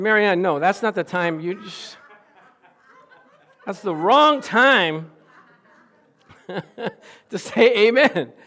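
loud, laughing through the words; laughing scold. Retold or imitated. Pastor laughing as he stops the amen. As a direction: scold her while cracking up.

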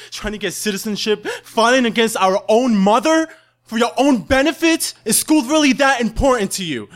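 loud, high, scolding rant; outraged sister. Retold or imitated. Sister berating him for his citizenship plan, loud and high. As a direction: tear into him with scripture. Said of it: "she got alterated and she said".

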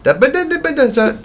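put-on high, loud toddler babbling; baby babble. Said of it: "she never stops talking".

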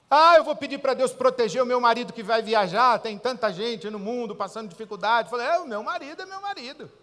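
preacher on praying for a traveling husband, fervent; impassioned preaching. Retold or imitated. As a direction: preach it hard. Objection then retort.

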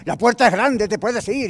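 loud, high telenovela outburst; shouted threat. Acted. Husband threatening wife in an argument, shouted. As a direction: yell it as a dramatic threat. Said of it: "le empiezo a gritar o empiezo a amenazarla".

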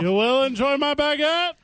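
high, hammed-up fake French accent; poorly done mock French accent. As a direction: ham up a bad French waiter accent. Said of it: "I can also do a fake French accent".

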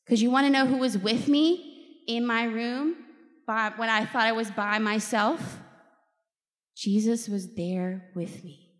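usual speaking voice

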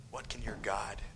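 taunting whisper. Acted. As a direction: whisper the dare.

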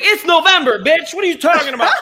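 high loud laughing yell; mock shout. Mom yelling at her son's fake prank, laughing shout. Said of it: "She's like".

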